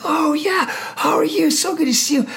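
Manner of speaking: high, bright mock-delighted greeting to the officer. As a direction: greet an old comrade with sudden delight. His own surprised greeting.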